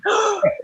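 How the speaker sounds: sharp high gasp, mocking a scandalized fan; mock shocked gasp. Mocking fans shocked at her cursing, mock gasp. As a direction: gasp like a prudish listener clutching pearls. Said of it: "those people like".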